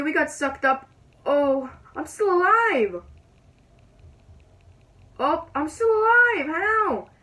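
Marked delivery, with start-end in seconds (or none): 1.2-7.1 high, loud, incredulous outcry; loud disbelief. Real, not acted. Gamer finding they survived the tornado, loud disbelief. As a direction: exclaim in disbelief, pitch climbing steeply.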